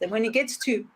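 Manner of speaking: ordinary talk, nothing marked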